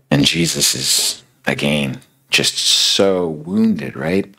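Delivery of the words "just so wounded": slow, stretched out, leaning on so; deeply wounded. Retold or imitated. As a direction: draw out just so wounded slowly and tenderly. Preacher dwells on Jesus' hurt.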